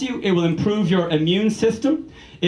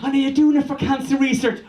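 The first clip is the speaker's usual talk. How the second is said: high, chirpy radio host voice; Ryan Tubridy impression; 6 semitones higher than usual. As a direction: gush like a cheesy radio presenter. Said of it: "Ryan Tubridy's like".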